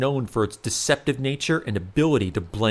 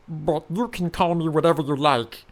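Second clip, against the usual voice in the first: changeling voice; 5 semitones higher than usual. Acted. Changeling continues.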